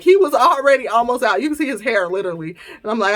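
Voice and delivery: normal talking voice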